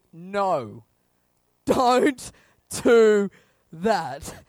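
loud, word by word; firm command. Acted. God stepping up the warning, firm loud command. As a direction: forbid it with a heavy beat on each word.

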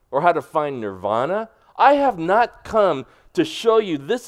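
rising preacher's declamation, punching not; forceful preaching. Acted. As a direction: preach Jesus' words with full force. Preaching Jesus' words forcefully.

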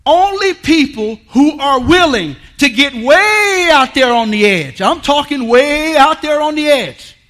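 loud, slow, stretched preaching cry; loud rising call on the edge; full-force preaching. Acted. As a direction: preach it out to the back row. Preaching climax.

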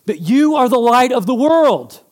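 high, loud fervent sermon voice; preaching at full force. Real, not acted. Pastor preaching Jesus' words to the congregation in full fervour. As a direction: preach it with passion.

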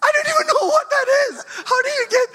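high, loud, fast disbelieving cry; can't believe what they're hearing; half-laughing exasperated shout; incredulous outburst. Real, not acted. Parent baffled by late request.

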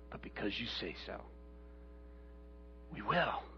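very low, very soft surrender; humble obedience. Acted. Peter's answer to Jesus repeated, hushed and humble. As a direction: say it gently, almost to yourself.

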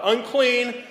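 high, loud cry of unclean; leper's loud warning cry; shouted warning. Retold or imitated. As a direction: call out a warning to the crowd. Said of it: "you had to shout out".

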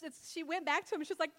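ordinary talk, nothing marked

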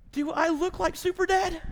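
high-pitched mock indignation; retold indignant question. Pastor retelling his spat with wife, high-pitched indignation. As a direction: play up the affronted husband, pitched high.

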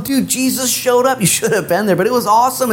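high-pitched, thrilled report to a buddy; excited bros. Acted. Disciples tell Tommy Boy what he missed, acted excitement. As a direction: burst out with amazing news, acted.

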